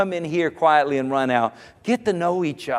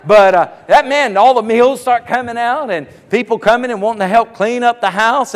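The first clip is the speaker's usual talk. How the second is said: normal talking voice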